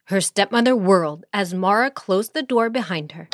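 usual speaking voice

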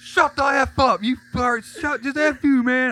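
high, slow furious yelling; raging yell. Retold or imitated. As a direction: scream it out in a rage. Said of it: "I was like".

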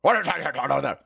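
lightly voiced cranky old man; gruff grandpa. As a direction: grumble like a baffled grandpa. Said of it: "My grandpa would be like".

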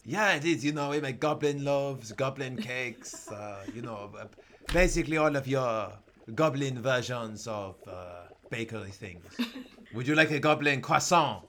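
put-on comic French-accented goblin. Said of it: "least racist accent I could do".

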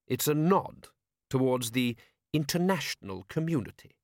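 measured patrician. Acted. Patrician addressing the gathered lords, smoothly diplomatic. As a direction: explain it with smooth official patience.

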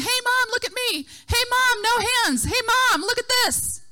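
put-on high-pitched kids clamoring for mom; playground children. Children on a playground calling for mom's attention, high kid voices. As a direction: imitate children calling out to show off. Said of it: "sea of children on a playground".

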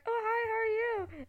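high, sugary customer-service chirp with a crack; a waxer faking cheer at a client; fake-sweet greeting. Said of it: "imagine me like this at work".

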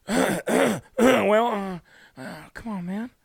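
lightly voiced mumbling old president; a hint of a fumbling Biden, come on man; Biden impression. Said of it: "Oh, Mister Biden".

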